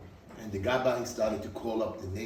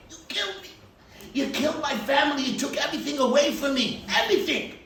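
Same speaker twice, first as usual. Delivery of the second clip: higher, louder grief-stricken plea; crying accusation; 13 semitones higher, 6 dB louder than usual. Retold or imitated. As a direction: accuse him through tears. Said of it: "Mister Friedman was crying".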